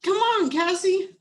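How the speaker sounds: put-on high, bright little-boy call; eager little brother. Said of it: "Phoebe said".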